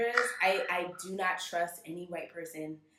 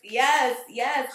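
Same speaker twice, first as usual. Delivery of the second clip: louder, higher emphatic agreement; emphatic yes; 6 semitones higher, 11 dB louder than usual. Retold or imitated. Eagerly agreeing with her friend, louder and higher. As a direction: agree with gusto. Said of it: "I was like".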